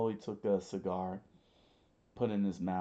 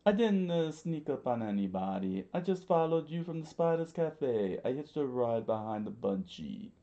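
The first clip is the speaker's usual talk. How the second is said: high, raised voice falling through each phrase; high-voiced underling explaining himself; defensive Mister Three character; 6 semitones higher, 4 dB louder than usual. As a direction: protest in a pitched-up cartoon voice.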